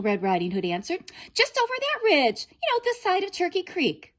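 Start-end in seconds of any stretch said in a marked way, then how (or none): 1.3-4.1 put-on high, loud little girl's voice. A narrator's reading. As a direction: answer brightly like a little girl. Girl answers wolf.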